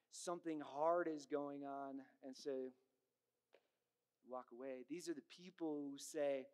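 ordinary talk, nothing marked

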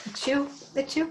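about to sneeze; words caught by a sneeze; sneezing. Real, not acted. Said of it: "I'm gonna sneeze for a minute".

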